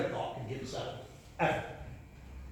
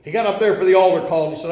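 ordinary delivery

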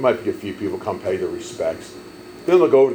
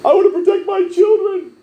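much higher and louder squeaky mock parent; mocking overprotective parent; 7 dB louder than usual. Pastor mocking parents who shield kids from funerals, high mock voice.

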